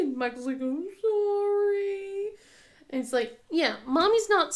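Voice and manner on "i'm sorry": put-on high, very slow, drawn-out kid apology; mimicking sheepish son. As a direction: sound like a little boy caught out. Imitating son's apology.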